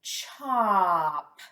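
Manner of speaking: each sound drawn out, merging into chop; slow blend. Shown as a demonstration. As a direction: stretch the sounds slowly into one word. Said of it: "Blend that with me".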